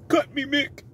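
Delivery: high-pitched mock boxer plea; hammed-up begging in a put-on boxer voice; Rocky. Boxer begging his trainer, hammed-up plea. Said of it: "And Rocky".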